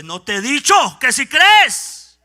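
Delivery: Jesús regaña y el predicador proclama, más agudo y fuerte; grítalo con fervor, despacio en las frases clave; predicación a gritos. Acted. Preaching at full force. (predicador clamando la resurrección a la iglesia)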